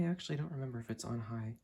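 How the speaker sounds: ordinary delivery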